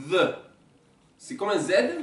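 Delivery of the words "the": The with the tongue between the teeth; voiced th like English "the"; th sound. Shown as a demonstration. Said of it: "avec la langue entre les dents".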